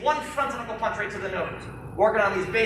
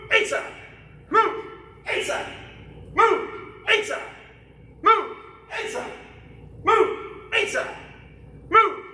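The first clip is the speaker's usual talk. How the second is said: drill command shout; 11 semitones higher, slower than usual. Real, not acted. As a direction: snap the command out to the class. Instructor calling drill commands.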